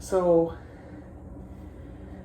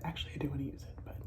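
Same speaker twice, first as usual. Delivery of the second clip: whisper; 3 semitones lower, 12 dB quieter, much faster than usual. Real, not acted. Self-correcting aside.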